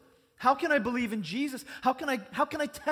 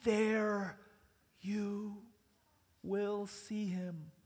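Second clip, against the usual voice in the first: slow, softer words with pauses; a soft, slow promise; hushed promise; 5 dB quieter, much slower than usual. Acted. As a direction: let each word land gently.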